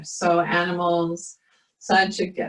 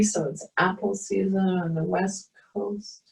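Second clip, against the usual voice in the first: guided meditation; 2 semitones higher than usual. Acted. Leads a yoga meditation.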